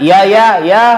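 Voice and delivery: grudging yes to an asking mother; irritated yes. Acted. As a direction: snap back impatiently. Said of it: "intonasi yang tidak mengenakkan".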